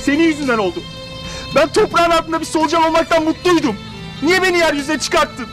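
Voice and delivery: angry. Acted. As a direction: lash out in hurt anger. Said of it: "Çok öfkeli".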